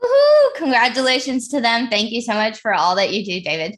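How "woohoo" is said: excited cheer. Real, not acted. Cheering a community champion.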